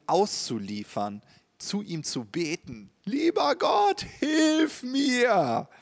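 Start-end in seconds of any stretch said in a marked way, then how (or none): 3.0-5.7 high, loud cry for help; desperate prayer. Pretend. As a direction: cry out to God in need. Betet in tiefster Niederlage. (verzweifelter Hilferuf, hoch und laut)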